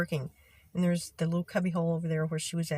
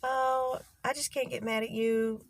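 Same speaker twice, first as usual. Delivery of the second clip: high, indulgent imitation of the boss to her favourite; lightly mocking sweetness; mock doting; 6 semitones higher, slower than usual. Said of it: "made a statement like that".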